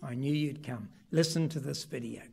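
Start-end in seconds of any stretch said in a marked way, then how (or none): 0.0-0.9 much softer, lower, tender repeat; quiet echo. Acted. Preacher applying the boy's words to the church, softly. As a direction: whisper the boy's words as a lesson. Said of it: "that sort of faith as that little boy".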